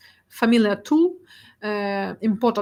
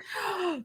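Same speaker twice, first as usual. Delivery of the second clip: quick high gasp of mock dismay; sharp gasp; 8 semitones higher, 11 dB quieter than usual. Real, not acted. Presenter joking about her kitchen photo, gasping. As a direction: catch the breath in a playful gasp.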